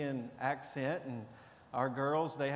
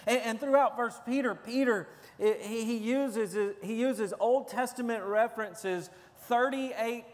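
higher, louder preaching drive; full-force preaching; 4 semitones higher, 4 dB louder than usual. Real, not acted. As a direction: preach it with rising urgency to the congregation. Preaching on First Peter.

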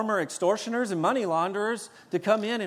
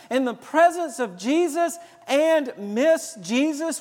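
ordinary delivery

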